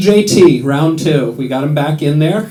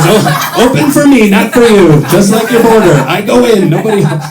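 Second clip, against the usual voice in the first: Trump boast; 4 semitones higher, 5 dB louder than usual. Retold or imitated. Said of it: "he goes".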